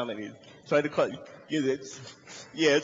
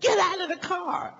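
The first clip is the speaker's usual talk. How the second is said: high-pitched yelled police command; mock cop shout, voice way up; shouting policeman. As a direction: yell an order like an armed cop. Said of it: "It's the police".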